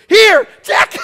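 very high, loud eager squeal; excited woman. Retold or imitated. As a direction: push his hand there, thrilled. Woman grabs his hand.

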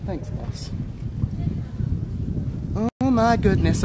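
ordinary talk

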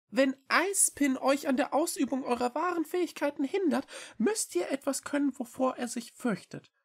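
very high, quick small-creature voice; little cat Echo, earnest. A narrator's reading. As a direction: plead eagerly in a high, fast voice. Cat argues. (hohe, eilige Katerstimme)

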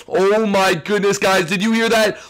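normal talking voice

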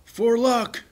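high, loud, gleeful echo; crowing. Acted. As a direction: crow it back like a proud little boy. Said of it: "crows Alan".

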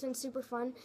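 usual speaking voice

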